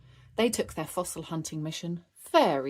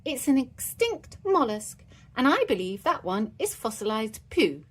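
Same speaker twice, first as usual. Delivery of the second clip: higher, earnest little expert voice; clever kid explaining; 6 semitones higher, 4 dB louder than usual. Acted. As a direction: play the proud know-it-all child. Edmund explains fossils.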